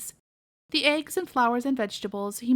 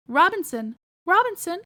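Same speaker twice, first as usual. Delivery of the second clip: calling out; 7 semitones higher, 5 dB louder than usual. Acted. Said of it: "called Aunt Porkis".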